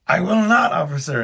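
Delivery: hammed-up refusal; defiant. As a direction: play the rebel to the cop. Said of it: "I was like".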